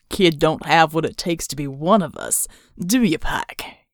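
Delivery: leering Reb leader. Acted. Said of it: "The Reb leader leered".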